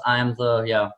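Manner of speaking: normal talking voice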